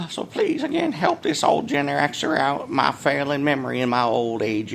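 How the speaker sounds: put-on high, creaky old-timer plea; mock old-timer. Vlogger begs viewers for help, high mock-aged voice. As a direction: beg like a feeble old-timer, easing down.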